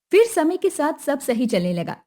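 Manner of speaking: ordinary delivery, nothing marked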